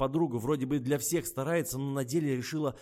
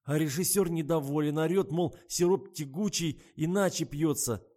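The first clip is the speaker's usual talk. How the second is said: high, loud griping; aggrieved complaint; 5 semitones higher, 6 dB louder than usual. A narrator's reading. Tasya grumbles about the director, aggrieved. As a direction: complain with rising exasperation.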